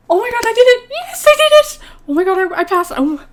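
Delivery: high, loud shriek of joy; ecstatic shout. Real, not acted. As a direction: scream it out, you passed. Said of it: "I'm so excited".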